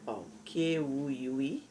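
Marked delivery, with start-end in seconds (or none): none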